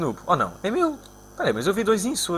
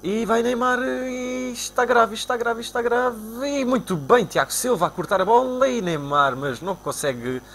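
mock commentator; 8 semitones higher than usual. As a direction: call it like a TV commentator. Live play-by-play of the match.

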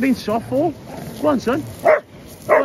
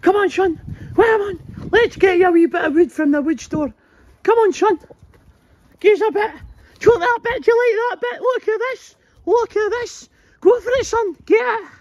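much higher, sing-song cooing at the dog; high dog talk; 13 semitones higher than usual. Real, not acted. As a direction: coax the dog in a high, playful voice. Cooing at his dog on a walk.

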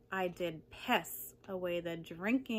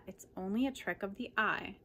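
ordinary delivery, nothing marked

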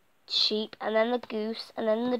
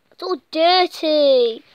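dismayed; 5 semitones higher, 11 dB louder, slower than usual. Real, not acted. Dismay at stained map.

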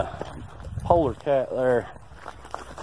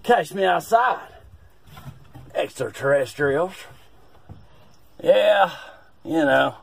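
put-on old man agent voice; 4 semitones higher, 6 dB louder than usual. Agent bragging in camper.

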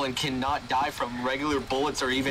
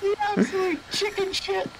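teasing laugh. Real, not acted. Teasing friend after scare.

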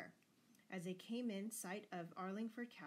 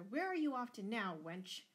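raised, demanding father; roared; 4 semitones higher, 6 dB louder than usual. A narrator's reading. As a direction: challenge her loudly, dropping off on the last word. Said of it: "roared her father".

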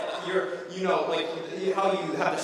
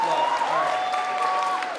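awkward drawn-out squeak; 3 dB louder, much slower than usual. Acted. Awkward silence after flopped joke.

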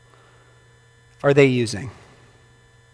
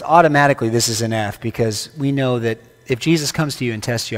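normal talking voice

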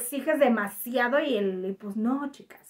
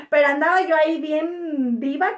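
laughing; 4 semitones higher, 7 dB louder than usual. Real, not acted. Laughs recalling necklace.

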